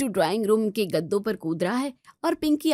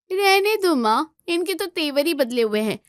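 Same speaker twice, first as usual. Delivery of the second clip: sulky complaint; 6 semitones higher, 4 dB louder than usual. Acted. Sister-in-law sulking at mother.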